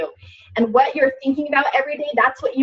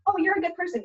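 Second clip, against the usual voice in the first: a light, higher angel on the shoulder, softer; sweetly voiced angel, softer and higher; 7 semitones higher, 5 dB quieter, faster than usual. Angel on her shoulder reassuring her, lightly voiced. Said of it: "angel and devil on my shoulders".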